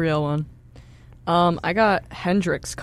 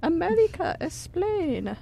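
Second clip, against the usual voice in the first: much higher, theatrical cry to America; mock dramatic plea; 12 semitones higher, 3 dB quieter, slower than usual. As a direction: throw the demand up high like a mock lament. Mock dramatic echo of demand.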